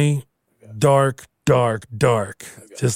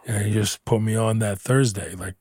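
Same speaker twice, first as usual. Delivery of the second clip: ordinary talk, nothing marked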